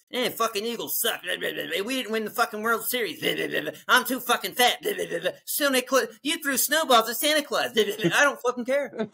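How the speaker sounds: a hint of a whiny Philly guy listing complaints; mock Philly complainer. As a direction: rattle off gripes like a hometown grouch. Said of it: "I don't wanna fucking hear it over there".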